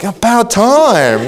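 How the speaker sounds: a high, slow, laughing "about time"; relieved gloat. Retold or imitated. Father recalling his first thought when the niece was bitten, a laughing gloat. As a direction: savor it with a chuckle, pleased it finally happened. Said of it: "my first reaction was like".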